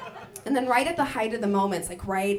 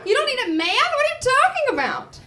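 high, loud disbelieving questions; astonished; 13 semitones higher, 7 dB louder than usual. Retold or imitated. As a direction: gasp at the news. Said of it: "I was like".

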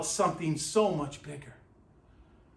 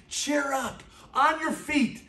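high, loud urging crowd; excited calling out; 7 semitones higher, 4 dB louder than usual. Retold or imitated. Crowd calling the blind man to Jesus, high and excited. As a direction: call him to his feet, eager.

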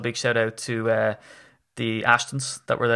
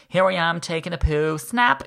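high, bright-eyed influencer voice; blogger voice; 6 semitones higher than usual. Retold or imitated. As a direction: gush it like a vlogger posing for a selfie.

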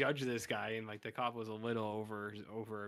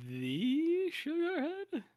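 much higher, slow drawn-out question; incredulous; 5 dB louder, much slower than usual. Pretend. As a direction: stretch it out in high disbelief. Mocking repeat of the odd name.

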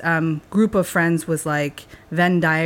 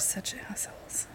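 hushed, breathy joking whisper; whispered insult; 15 dB quieter than usual. Real, not acted. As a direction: whisper it under your breath. Teasing banter, mock insult.

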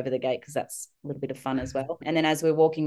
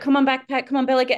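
higher, louder mock summons; playful parent calling; 10 semitones higher, 5 dB louder than usual. Mum calling her daughter as a pretend backpack, higher playful call. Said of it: "swinging her around going".

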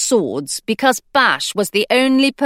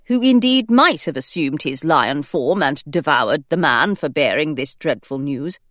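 plain reading voice

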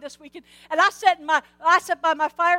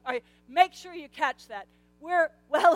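usual speaking voice

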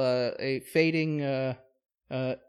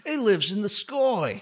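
higher comic English voice; mock British accent; 5 semitones higher than usual. Imitating an Englishwoman in awe of her boss, mock British accent. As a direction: lay on a cartoon English accent.

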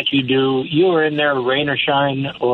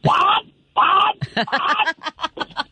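very high, piercing-pitched mock yell; comic high-pitched motherly shriek; yelling mother impression; 14 semitones higher, much slower than usual. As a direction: imitate your mother yelling from the next room. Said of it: "what my mother sounds like when she yells".

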